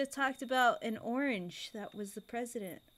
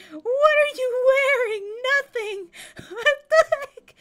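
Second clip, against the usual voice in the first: shocked; 12 semitones higher, 11 dB louder than usual. Real, not acted. Player shocked by a naked character. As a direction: shriek in startled disbelief.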